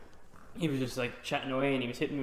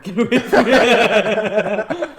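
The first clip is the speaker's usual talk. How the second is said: slow, louder, laughing dismissal; laughing shoo; 7 semitones higher, 12 dB louder, much slower than usual. Retold or imitated. Shooing the scammer.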